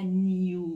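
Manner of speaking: "نیو" with a y-glide after the n; British "نیو". Shown as a demonstration. Teacher showing how British speakers say it, "نیو" with a y-glide.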